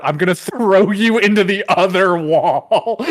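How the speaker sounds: retold threat breaking into laughter; mock menace dissolving into laughs; laughing mock threat. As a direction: crack up while playing the threat.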